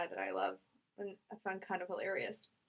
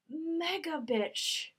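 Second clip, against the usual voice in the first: drawn-out catty jab; 6 semitones higher, much slower than usual. Acted. Catty dig at a character.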